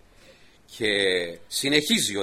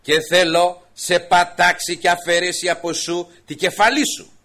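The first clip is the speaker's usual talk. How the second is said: slow, loud, higher declaration; solemn loud vow; 5 semitones higher, 9 dB louder than usual. Acted. David vowing victory over Goliath, slow and loud. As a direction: weigh each word like a verdict. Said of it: "ο Δαβίδ συνεχίζει τον τρίτο λόγο".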